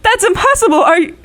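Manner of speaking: the floor drops out from under her; shocked disbelief. Acted. Wife told her husband is dead, in shocked disbelief.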